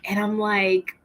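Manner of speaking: normal talking voice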